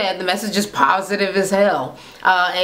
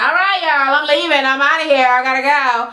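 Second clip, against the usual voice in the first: raised, singsong goodbye, imitating her; breezy mock farewell; 5 semitones higher than usual. Acting out her grand exit, mock breezy farewell. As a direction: sing out a showy exit line.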